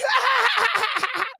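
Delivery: sudden high burst of laughter. Real, not acted. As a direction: cackle at your own joke. Laughs at his own joke.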